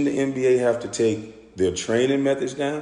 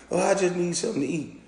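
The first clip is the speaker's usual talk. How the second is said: imitating girl; 8 semitones higher, 3 dB quieter, faster than usual. Voicing the young lady.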